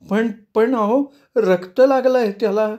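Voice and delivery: slow, high, stammering fear; hushed frightened voice. A narrator's reading. Sushupti, shaken, tells Panini the knife has blood, hushed. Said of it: "ती दबलेल्या आवाजात म्हणाली".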